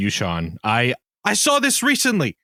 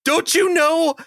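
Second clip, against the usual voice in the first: higher-pitched, slow, incredulous rant; heated; 6 semitones higher, slower than usual. Real, not acted. Guest ranting about brass players on a plane, heated. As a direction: drag out the complaint, worked up. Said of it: "I'm sorry I'm getting so heated".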